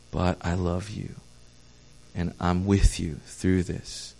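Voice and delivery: soft and low, gently comforting; tender reassurance. Retold or imitated. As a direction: hold them close with your voice. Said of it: "and be like".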